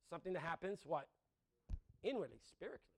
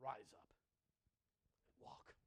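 hushed command; 11 semitones lower, 18 dB quieter, much slower than usual. Acted. Preacher quoting Jesus to paralytic, slow soft whisper.

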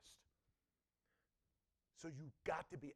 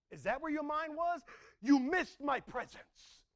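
louder, higher, slow pointed rebuke; God rebuking; 9 semitones higher, 8 dB louder, slower than usual. Acted. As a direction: let each word land as God's reproach. Pastor voices God rebuking worshippers.

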